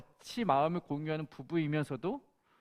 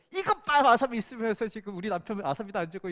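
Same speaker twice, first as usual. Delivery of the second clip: much higher, louder sharp accusation; mock angry wife; 4 semitones higher, 4 dB louder than usual. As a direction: pretend wife siding with husband, scolding. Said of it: "이랬어야 되나?". (아주 높고 큰 소리로 따지듯)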